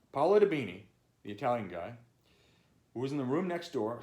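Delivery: plain reading voice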